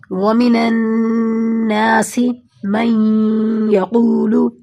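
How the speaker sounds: slow recitation, the نون of النَّاسِ held through the nose; ghunna recitation. Shown as a demonstration. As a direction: hum the doubled نون in النَّاسِ, slowly. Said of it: "نون کو نون میں ملا رہے ہیں". (النَّاسِ کے نون پر غنہ کے ساتھ آہستہ تلاوت)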